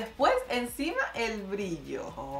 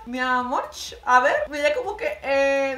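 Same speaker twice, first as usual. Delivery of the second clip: excited; 3 semitones higher, 9 dB louder than usual. Real, not acted. Reacting to the nose contour.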